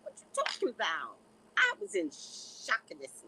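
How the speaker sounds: ordinary talk, nothing marked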